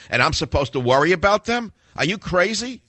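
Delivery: high-pitched, disbelieving questions; incredulous outburst. Real, not acted. Host rejects worrying about knockout gamers, incredulous. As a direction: climb sharply in pitch, scoffing in disbelief. Host scoffs at the argument just read.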